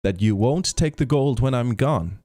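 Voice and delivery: a hint of an earnest little leprechaun; pleading leprechaun. A narrator's reading. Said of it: "The leprechaun promised".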